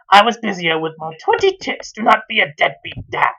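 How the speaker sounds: plain reading voice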